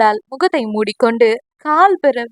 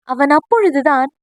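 plain reading voice

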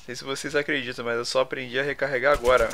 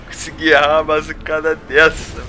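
frustrated yelling; 3 semitones higher, 8 dB louder than usual. Real, not acted. Missed shots, lost shield.

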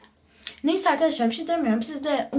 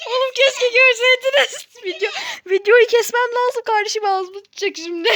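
high, laughing through the words; 9 semitones higher, 3 dB louder than usual. Real, not acted. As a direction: talk while still laughing.

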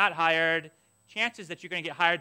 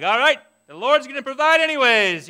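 bright loud cheer, higher; mock cheerful faith; 6 semitones higher, 9 dB louder than usual. Seminarian imagining a cheerful faithful response, loud and upbeat. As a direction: sing out trust in the Lord. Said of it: "I wish that I would have been right there being like".

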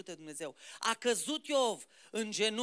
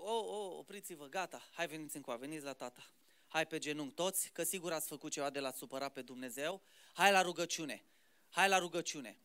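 lower, softer, coaxing call to prayer; imitating a praying parent; 7 semitones lower, 4 dB quieter, faster than usual. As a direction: gather the children gently onto their knees. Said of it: "Am un astfel de părinte".

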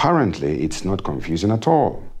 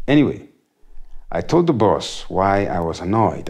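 ordinary talk

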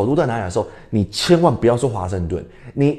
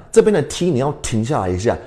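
normal talking voice

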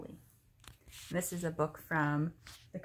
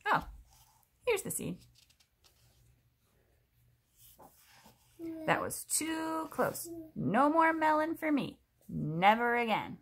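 relieved crocodile; 10 semitones higher, 4 dB louder, slower than usual. Acted. Crocodile relieved, swearing off melon.

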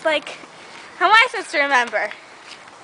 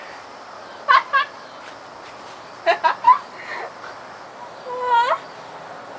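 breathy creepy laugh; unsettling rising laugh; eerie laugh; 6 semitones higher than usual. Acted.

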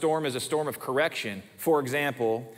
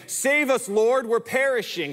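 desperate plea; 8 semitones higher, 3 dB louder than usual. Acted. Disciples cry out, quoted.

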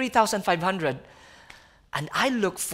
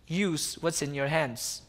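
quiet inner voice; 6 semitones lower, 7 dB quieter, slower than usual. Retold or imitated. God's answer voiced in story.

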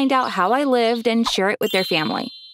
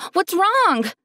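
high, alarmed call out; panicked yell; 9 semitones higher than usual. Acted. Daphne yelling to her sobbing friend, panicked. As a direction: cry it out in sudden worry. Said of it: "I sat up and yelled".

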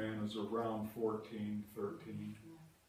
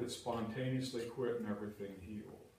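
normal talking voice

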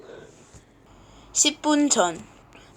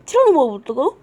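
ordinary delivery

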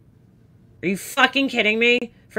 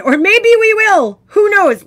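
mocking letter voice; 12 semitones higher, 9 dB louder, slower than usual.

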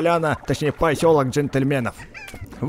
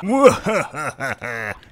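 evil laugh. Pretend. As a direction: laugh like a cartoon villain. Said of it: "Это типа зловещий смех".

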